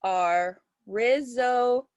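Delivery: slow, spaced out word by word; composing aloud. Shown as a demonstration. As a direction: try the line out slowly, counting beats. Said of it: "I'm gonna put".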